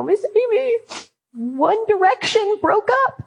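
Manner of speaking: high, wailing schoolgirl voice; teen fan crying to her teacher, high and wailing; sobbing teen girl. Retold or imitated. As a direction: mimic a heartbroken fourteen-year-old, way up high. Teen girls sobbing over band.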